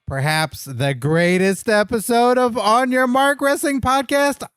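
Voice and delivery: high, ringing ring announcer; grand entrance announcement. Acted. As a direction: open the show like a ring announcer. Host opens the show grandly.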